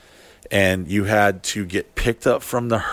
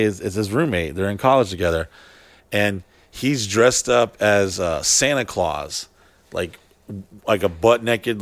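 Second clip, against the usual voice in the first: ordinary talk, nothing marked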